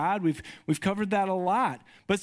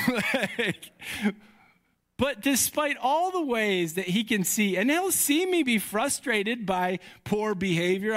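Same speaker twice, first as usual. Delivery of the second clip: ordinary talk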